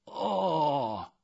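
high, drawn-out “哦” of realization; clerk's long high “oh”; dawning understanding. A narrator's reading. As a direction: let the penny seem to drop. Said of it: "那头似乎会意了".

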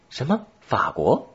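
put-on high, startled child's question; surprised boy. A narrator's reading. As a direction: pitch shooting up in boyish surprise. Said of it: "男孩惊讶地问道". (男孩惊讶地高声问)

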